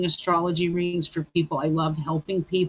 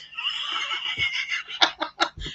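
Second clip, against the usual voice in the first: shrieking burst of laughter; 5 dB quieter than usual. Real, not acted. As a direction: let out a high squealing laugh. Laughing at her own story.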